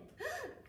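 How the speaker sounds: a quick high gasp of surprise; sharp gasp. Acted. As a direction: gasp as if she just sat on something. Gasp at sitting on cat.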